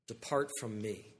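soft, low and slow; grave quiet command. Acted. Jesus sending false believers away, soft and grave. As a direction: say it heavy and hushed. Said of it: "the most difficult words".

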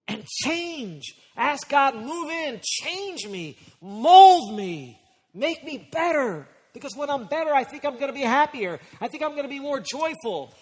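high-pitched, pleading pulpit climax; impassioned, surging exhortation; fervent preaching. Real, not acted. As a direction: plead it out like a prayer preached aloud. Preaching at full force.

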